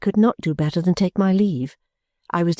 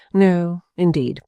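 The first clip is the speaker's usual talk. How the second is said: slow, composed and pleasant; smiling perfect composure; charming tone; slower than usual. A narrator's reading. Mrs Jellyby repeats herself while sorting letters, charming. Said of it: "in quite a charming tone of voice".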